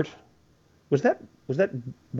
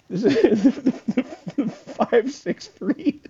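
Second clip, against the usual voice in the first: laughing; 11 semitones higher, much slower than usual. Real, not acted. Host laughing reading text.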